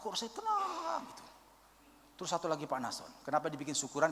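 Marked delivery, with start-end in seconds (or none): 0.0-1.2 high, soft and long-drawn; amazed relief. Retold or imitated. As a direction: float "tenang" up high and soft, held long. Retold testimony of deep peace. (lega penuh heran, tinggi, lembut dan panjang)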